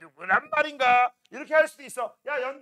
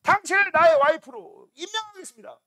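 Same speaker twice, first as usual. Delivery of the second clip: higher, louder royal decree; grand prince proclamation; 8 semitones higher, 9 dB louder than usual. Acted. Prince proclaiming to his chosen bride, loud and grand. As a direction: announce it like a pompous prince. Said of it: "우리 왕자님이".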